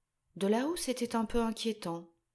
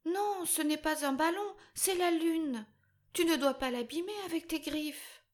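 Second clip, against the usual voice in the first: tearful pleading; 8 semitones higher than usual. Acted. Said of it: "Lara se mit à pleurer".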